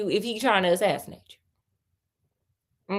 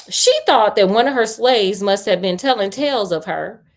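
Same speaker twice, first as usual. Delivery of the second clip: storyteller reading aloud, a little louder; story narration; 3 semitones higher, 6 dB louder than usual. A narrator's reading. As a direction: read the tale to a friend, a touch dramatic. Reads the tale aloud.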